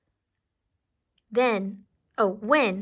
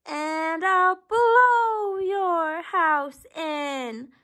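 very slow, drawn-out, higher wolf threat; slow storybook bellow, pitched up; shouting wolf; 6 semitones higher, much slower than usual. A narrator's reading. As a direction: draw out every word as the big wolf. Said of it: "shouted the wolf".

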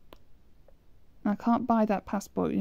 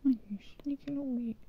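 whispering; 4 semitones higher, 6 dB quieter than usual. Real, not acted. Teasing whisper at the game.

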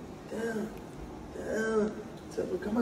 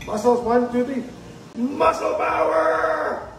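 cheering count; 5 semitones higher, 6 dB louder than usual. Real, not acted. Counting kid through the push.

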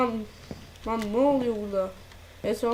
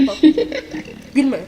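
nervous laughing; 3 semitones higher, 5 dB louder than usual. Real, not acted. Laughs nervously at creepy song.

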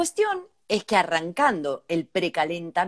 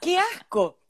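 disgusted; 10 semitones higher, slower than usual. Real, not acted. Disgust at the story.

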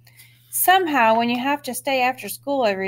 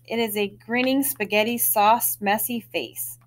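usual speaking voice

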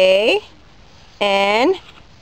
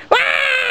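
high mock shriek; playful scream; 13 semitones higher than usual. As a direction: squeal as if startled.

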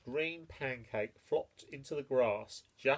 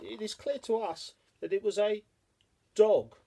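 high buzzing fly voice, loud at the end; 7 semitones higher, 7 dB louder than usual. Acted. The flies telling the mole the culprit, building loud. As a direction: buzz then announce it like a fly. Flies give their verdict.

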